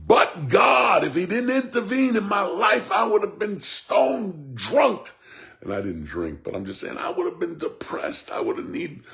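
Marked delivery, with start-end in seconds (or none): 0.1-5.1 loud high preacher shout of but God; full-voiced declaration, rising high. Shown as a demonstration. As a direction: cry it out to the congregation. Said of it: "I want you to start to say".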